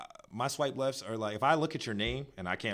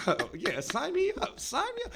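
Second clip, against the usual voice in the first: eager squeal. Retold or imitated. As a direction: sign me up, high-pitched and giddy. Eager mock enthusiasm.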